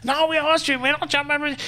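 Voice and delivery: high-pitched mock protest, far above own voice; indignant Austrians. Troublemaker historian mimics Austrians denying they're German, high mock indignation. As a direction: put on a high, offended voice in denial. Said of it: "They're like".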